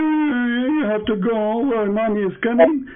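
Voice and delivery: high, slow taunting singsong; mocking baby talk. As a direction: talk down to him like a toddler. Mocking caller as a child.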